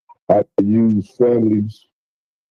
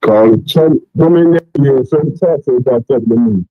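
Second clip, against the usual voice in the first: muffled phone line, voice dulled; 4 semitones higher, 5 dB louder than usual. Real, not acted. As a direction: sound like a covered phone mic. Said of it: "you sound kinda muffled up".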